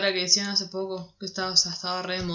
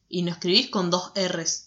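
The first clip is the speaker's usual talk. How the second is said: ordinary talk, nothing marked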